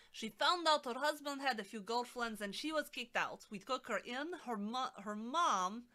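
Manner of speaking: loud amateur Russian accent; exaggerated, not-quite-accurate Russian accent. Shown as a demonstration. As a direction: read it out in a hammed-up Russian accent. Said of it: "All right, we'll try to do Russian".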